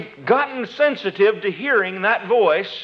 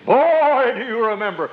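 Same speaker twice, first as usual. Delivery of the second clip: loud, blunt voice; 3 semitones higher than usual. Shown as a demonstration. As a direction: announce it loudly, not as a whisper. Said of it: "it doesn't come to you and say".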